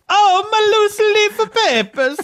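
very high, slow mock chef voice; imitating the chef. Co-host mimics the chef's cry, very high and slow. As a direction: copy the frantic chef back at him. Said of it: "the part where he goes".

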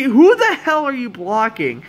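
real frustration at a bad play; exasperated outburst. Real, not acted. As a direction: demand it of your own team, pitch jumping up. Upset at his team's blocking.

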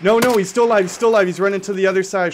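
shouting a warning to a teammate; loud urgent callout. Real, not acted. Teammate shouting enemy still alive.

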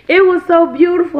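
high, loud mock-weepy praise; hammed-up emotional child; imitating tearful child. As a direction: play a boy moved to tears. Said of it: "busted out in these tears".

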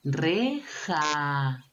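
"Reja" as re, then a long jaaa; sounded out syllables. Shown as a demonstration. Teacher letting children hear the ending, stretched "Reja". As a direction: draw out the last A of "Reja". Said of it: "¿Cuál terminará con I?".

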